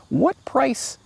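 usual speaking voice

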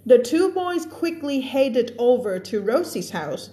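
slow storybook read-aloud; story reading. Acted. Teacher reading a story aloud to her students, slow and careful. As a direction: read it out slowly for the class.

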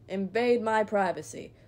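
usual speaking voice

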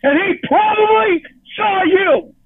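yelling at Jamie through tears; furious crying shout. Real, not acted. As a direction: scream it through tears at her.

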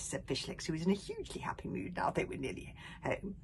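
ordinary delivery, nothing marked

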